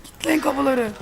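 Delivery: high, loud panicked no; real panic shout. Real, not acted. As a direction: yell as the door swings open. Door opens unexpectedly.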